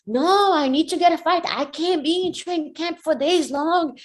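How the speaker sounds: high-pitched, heated pleading; acted-out exasperated refusal; angry protest. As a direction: insist loudly, pitch way up. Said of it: "I've been crazy angry".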